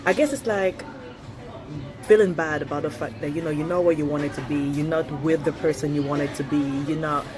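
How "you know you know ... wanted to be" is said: normal talking voice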